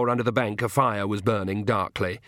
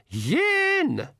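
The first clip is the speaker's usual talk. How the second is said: high, drawn-out count from the crowd; pixie shout. A narrator's reading. Pixie band counts before firing, high mock shout. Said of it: "shouted the Nac MacFeagle".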